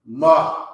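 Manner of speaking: Ma with a light breathy ha on the end; teacher's model Ma with a trailing breath; model pronunciation. Shown as a demonstration. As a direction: breathe a little ha after Ma. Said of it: "you put that ha on the end".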